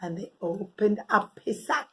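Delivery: ordinary delivery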